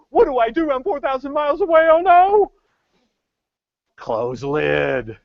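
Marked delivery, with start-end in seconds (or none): 0.1-2.5 high, loud panicked wail; as if your daughter's in the tub with a boy, panic; panicked dad. Retold or imitated. Dad far away panicking about the hot tub, high loud wail.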